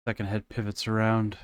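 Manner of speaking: normal talking voice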